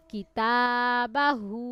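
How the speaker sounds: কিতাবাহু read very slowly, the long "তা" held; drawn-out vowel. Shown as a demonstration. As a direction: model the stretched vowel in কিতাবাহু. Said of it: "এক আলিফ টেনে পড়তে হয়".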